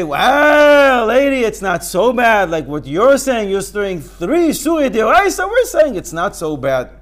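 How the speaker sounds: high, loud coaxing; hammed-up soothing the lady; mock reassurance.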